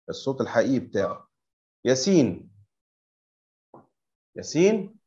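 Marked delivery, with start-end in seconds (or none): none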